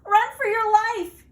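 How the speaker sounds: very loud, high terrified yell; panicked yell. Acted. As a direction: yell at them to flee. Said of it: "yelled Rabbit".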